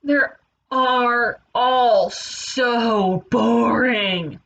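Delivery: very slow, drawn-out bored groan; bored complaint. Acted. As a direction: stretch every word in boredom. Wolf voiced complaining of boredom.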